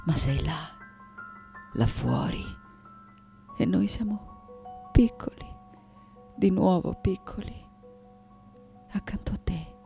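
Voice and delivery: soft, breaking into near-whisper; hushed tenderness. Acted. Daughter feeling small beside her mother, hushed. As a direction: let it fade into a small, intimate hush.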